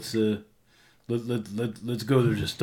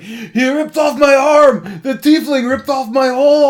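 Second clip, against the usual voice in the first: high, loud, outraged wail; screaming; 13 semitones higher, 10 dB louder than usual. Acted. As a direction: cry out to the crowd as if maimed. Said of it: "he starts screaming".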